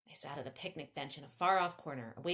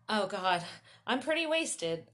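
wasted admission; 3 semitones higher, 7 dB louder than usual. A narrator's reading. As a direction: admit it loudly, pitch up. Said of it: "I admitted".

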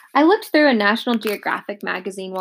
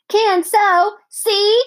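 put-on high, louder little-kid insistence; toddler voice; 12 semitones higher, 6 dB louder, much slower than usual. A narrator's reading. Little Fudge insisting to the dentist, high and stubborn.

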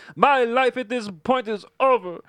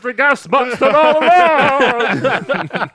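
hammed-up villain reveal; 8 dB louder, slower than usual. Player's loud slow joke line.

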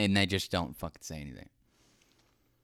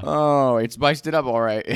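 laughing; 6 semitones higher, 9 dB louder than usual. Real, not acted. Laughing, loud emphatic.

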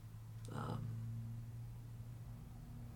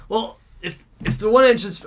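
high worried question; nervous passenger; 11 semitones higher, 6 dB louder than usual. Retold or imitated. Scared passenger asking the drunk about the engine, high and anxious. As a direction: ask it fretfully, pitched up. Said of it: "he's like".

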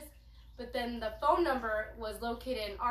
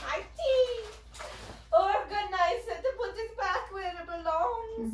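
mock wail; 9 semitones higher, 7 dB louder, slower than usual. Mock wail over tea.

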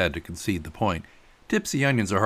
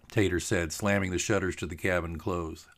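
plain reading voice

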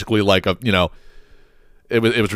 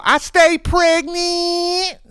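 much higher, very slow, stretched-out mock girl voice; mock imitation of a bragging young woman; much slower than usual. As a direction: drag it out high like the bragging girl. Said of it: "the one that's like".

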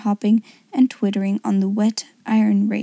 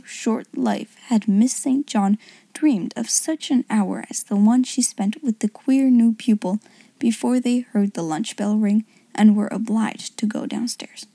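plain reading voice

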